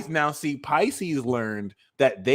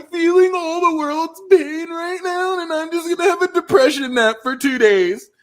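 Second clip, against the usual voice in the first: high, loud put-on whine; hammed-up world-weary wail; mock wailing; 14 semitones higher, 7 dB louder than usual. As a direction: play the melodramatic sufferer, high and loud. Acting a Pisces wallowing in pain.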